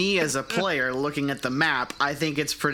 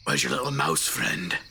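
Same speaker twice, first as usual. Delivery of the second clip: deep Grok voice; 11 semitones lower than usual. Acted. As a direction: drop way down to play Grok. Player voicing Grok.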